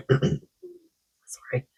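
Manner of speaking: throat clearing into a whisper; losing voice. Real, not acted. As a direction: voice giving out, breathy apology. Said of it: "losing my voice".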